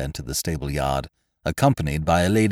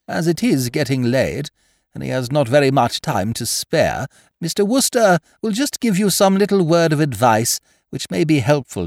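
higher, prim schoolmistress voice; prim headmistress; 9 semitones higher, 4 dB louder than usual. Acted. Headmistress to Wooster before the girls, prim and proper. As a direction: speak like a strict headmistress winding things up. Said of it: "Miss Tomlinson voiced as headmistress".

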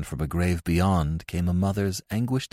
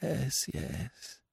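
soft, higher-voiced hushing; soothing priest; 8 semitones higher, 10 dB quieter than usual. A narrator's reading. Priest hushes anguished mother, soft and soothing. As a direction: soothe very softly. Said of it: "said the priest".

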